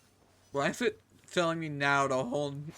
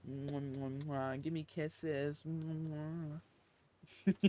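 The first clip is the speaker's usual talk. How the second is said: soft kissing sounds; double air kiss; 5 dB quieter, slower than usual. Real, not acted. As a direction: blow quiet kisses at the pet. Kissing the pet.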